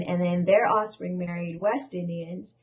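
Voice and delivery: usual speaking voice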